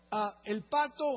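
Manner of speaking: normal talking voice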